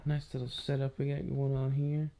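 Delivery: soft late-night whisper. Real, not acted. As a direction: hush it so nobody wakes. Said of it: "I'm whispering 'cause Crystal's sleeping".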